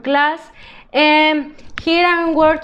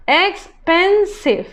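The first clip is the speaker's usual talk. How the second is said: slowly sounded out; 4 semitones higher, slower than usual. Shown as a demonstration. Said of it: "teacher sounds out word".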